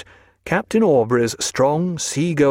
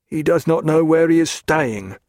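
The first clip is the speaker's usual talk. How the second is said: guard explaining; 4 semitones higher than usual. A narrator's reading. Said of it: "he said".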